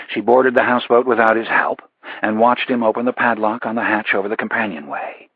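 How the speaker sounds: ordinary delivery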